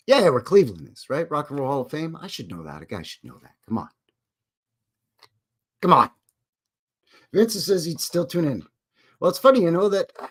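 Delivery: usual speaking voice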